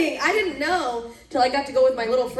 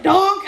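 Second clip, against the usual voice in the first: comic donk; 7 semitones higher, 6 dB louder than usual. Retold or imitated. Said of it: "was like".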